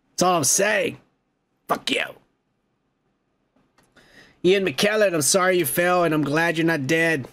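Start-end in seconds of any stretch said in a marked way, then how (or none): none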